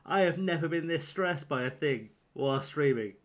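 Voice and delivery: ordinary talk, nothing marked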